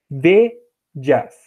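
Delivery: "Vedias" split slowly as ve, pause, dyas; two syllables. Shown as a demonstration. Teacher demonstrating merged vowels, "Vedias" slowly in two parts. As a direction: set two beats apart in "Vedias", ve-dyas. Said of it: "tão fraquinho que ele junta com A".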